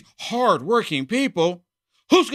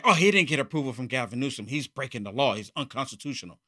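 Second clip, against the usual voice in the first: low, fast, quieter mock complaint, dropping away; mocking whine; 7 semitones lower, 6 dB quieter than usual. Mocking Democrats' complaint about Trump, low and fast. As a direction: scoff at them by whining their words lower and faster. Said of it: "This is what they're saying".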